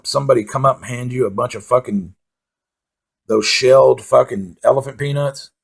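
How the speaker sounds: normal talking voice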